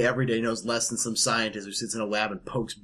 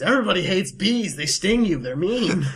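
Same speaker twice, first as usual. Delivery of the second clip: raised, loud mock outrage; loud rant; 8 semitones higher, 6 dB louder than usual. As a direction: rail against bees, loudly. Mock rant against bees.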